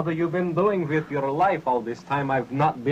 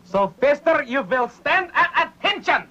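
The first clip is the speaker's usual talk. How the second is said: drill sergeant; 10 semitones higher than usual. Acted. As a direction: shout commands like a drill sergeant. Drill instructor barking orders.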